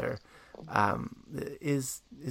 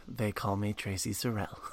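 quoting through a laugh; 4 semitones lower, 6 dB quieter than usual. Retold or imitated. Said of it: "I love that you lead with".

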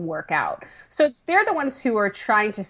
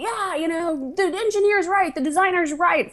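high, sing-song mock agreement; 9 semitones higher than usual. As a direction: parrot the party line, pitched up. Mocking everyone's agreement.

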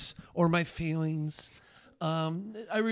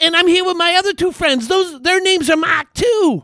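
high, shouted Boston impression; imitating an excited Boston kid at full volume; Boston accent; 11 semitones higher, 16 dB louder than usual. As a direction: holler it with a Boston accent, Rs dropped. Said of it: "It's a Boston accent".

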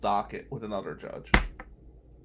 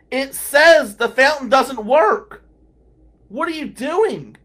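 high, slow, loud disbelief; incredulous shout; 12 semitones higher, 14 dB louder, slower than usual. Retold or imitated. Lawyer telling a colleague the fountain doesn't work, shouting in disbelief. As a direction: spell it out loudly to someone who won't listen.

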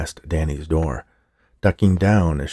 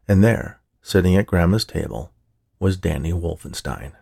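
ordinary delivery

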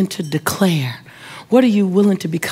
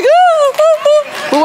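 very high, loud wailing boo-hoo; hammed-up comic sobbing wail; mock ugly cry; 5 dB louder, much slower than usual. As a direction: bawl in exaggerated falsetto bursts. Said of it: "I'm doing the ugly cry now".

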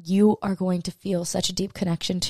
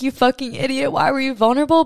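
higher, mocking inner critic; ego's voice; 6 semitones higher than usual. Her ego sneering at her for being vulnerable, high mocking voice. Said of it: "my ego's chiming in".

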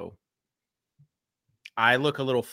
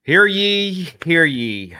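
luide, hoge omroepersroep; town crier; 7 semitones higher, 9 dB louder, much slower than usual. Acted. Town crier opening call.

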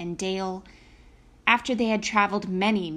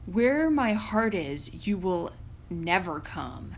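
giant voice; 2 semitones lower than usual. A narrator's reading. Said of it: "said the giant".

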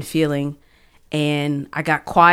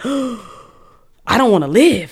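reliving despair; 5 semitones higher than usual. Retold or imitated. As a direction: let the old hopelessness crack through, dragging the words. Reliving despair after a gasp.

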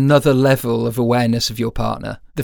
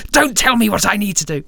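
imitating his wife snapping back, pitched up; a hammed-up irritated comeback, higher; mock snappy retort; 8 semitones higher than usual. Wife snapping at him when he gives advice, mock high retort. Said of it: "She's like".

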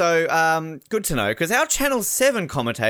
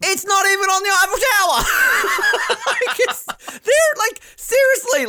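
words squeezed out high through real laughter; can't stop laughing as you say it; 5 dB louder than usual. Real, not acted. Cracking up to a co-host over the fake flag, laughing.

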